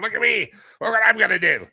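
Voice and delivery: high, loud mock show-off. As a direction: brag like a smug diner showing off. Mocking fancy show-offs.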